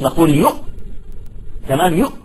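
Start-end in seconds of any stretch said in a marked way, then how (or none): 0.3-0.5 "يؤ" stopped dead on the hamza; short rising "يؤ" closed by a glottal stop; sound cut off. Shown as a demonstration. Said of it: "ينقطع الصوت". 2.0-2.1 short "يؤ" ending in a glottal stop; rising "يؤ", chopped by the hamza; sound cut off. Shown as a demonstration. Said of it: "الهمزة انقطعت".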